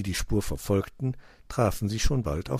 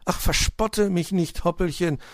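complaining; 10 semitones higher, 4 dB louder than usual. A narrator's reading. Cat to the mocking hare, complaining. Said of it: "beschwerte sich Mauz".

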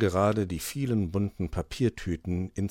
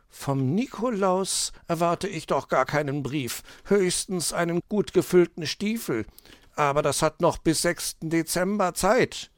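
higher fox voice, lightly puzzled; fox character voice; 10 semitones higher, 3 dB louder than usual. Acted. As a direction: voice the fox musing aloud.

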